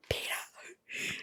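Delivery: breathy whispered name. Retold or imitated. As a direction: whisper it so only he hears. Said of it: "And she whispers".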